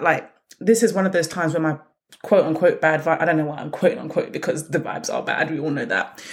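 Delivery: usual speaking voice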